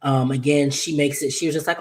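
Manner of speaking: normal talking voice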